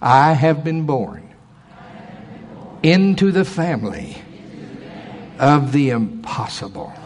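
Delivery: slow and deliberate, a word at a time; slow declaration. Shown as a demonstration. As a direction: declare it to your neighbour. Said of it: "Turn to somebody and say".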